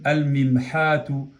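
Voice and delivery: "al mimhatu" slow, L sounded before the m; lam pronounced. Shown as a demonstration. Teacher demonstrating the article before mim, slowly pronounced lam. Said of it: "hemos pronunciado la lam".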